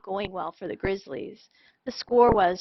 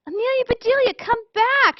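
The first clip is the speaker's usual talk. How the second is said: high, loud calling out; shouted; 14 semitones higher, 6 dB louder than usual. Acted. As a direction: call after her as she runs away. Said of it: "shouted the boys".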